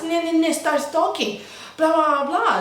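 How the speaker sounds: high, mimicked nagging babble; mock her going on and on; mocking chatter. Imitating the Spanish girl as she starts talking, mocking babble. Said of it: "And then she starts".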